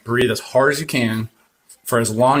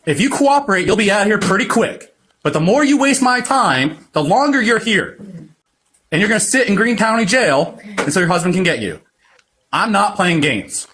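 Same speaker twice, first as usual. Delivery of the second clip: angry scolding; 5 semitones higher than usual. Real, not acted. Officer scolds arrested driver.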